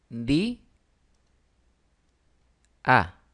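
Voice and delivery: "di a" as two isolated syllables, long pause between; "di... a", each syllable standing alone; split into syllables. Shown as a demonstration. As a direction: break "di a" apart, one syllable at a time. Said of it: "vamos a separarlas en sílabas".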